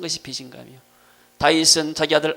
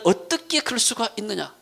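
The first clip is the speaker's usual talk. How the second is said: indignant disbelief; 7 semitones higher than usual. Acted. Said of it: "믿음이 없게 되면은".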